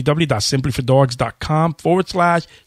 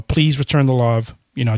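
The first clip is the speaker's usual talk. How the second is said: ordinary talk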